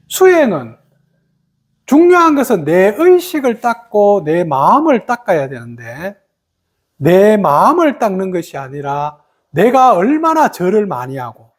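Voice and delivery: ordinary talk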